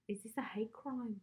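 shaky attempt at a British accent; amateur mock-British question. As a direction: put on an uncertain British accent. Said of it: "I don't think that was British".

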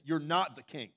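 hammed-up telling-off; mock yelling. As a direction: yell it like a mean grown-up, in jest. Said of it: "nobody's yelling at seven-year-old kids".

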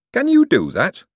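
high, surprised question. Acted. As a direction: sound amazed he can. Hubert amazed.

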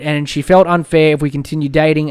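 ordinary talk

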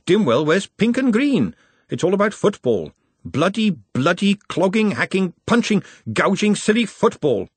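high-pitched bitter rant about football. A narrator's reading. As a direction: spit out each word with bitterness. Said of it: "The bitterness in Glenda's voice".

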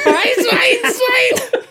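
very high, drawn-out goofy impression, laughing; imitating movie character. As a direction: mimic the character loudly through fits of laughter. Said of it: "when he's giving the".

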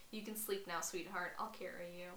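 soft, reassuring murmur; hushed, caring quote; tender soothing. Retold or imitated. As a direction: lull a loved one gently. Edward's tender quote to injured Bella.

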